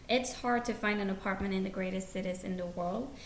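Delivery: normal talking voice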